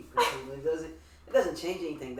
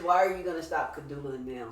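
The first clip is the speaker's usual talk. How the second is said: ordinary talk, nothing marked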